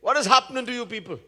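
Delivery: retold king's loud, high call; shouted. King inside palanquin to his bearers, shouting. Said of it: "the king inside that palanquin shouted".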